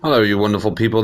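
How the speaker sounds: usual speaking voice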